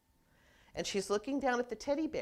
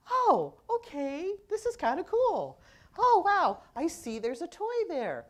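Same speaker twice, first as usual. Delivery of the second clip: put-on much higher, louder baby voice full of wonder; high squealy toddler glee, louder; delighted baby; 8 semitones higher, 9 dB louder than usual. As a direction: play the baby thrilled to find the toy. Baby voiced discovering the toy.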